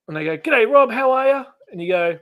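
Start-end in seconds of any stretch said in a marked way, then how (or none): 0.4-1.4 high, loud, cheery hello; eager fan greeting. Retold or imitated. Fan hails Rob in the street, high loud greeting. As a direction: call it out like a keen fan. Acting out fans greeting him.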